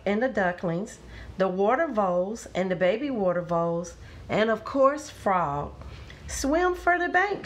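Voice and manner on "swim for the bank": high, urgent call; storybook shout, raised in pitch more than volume; shouted order. A narrator's reading. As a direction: call out high as if across water. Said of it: "shouted Frog".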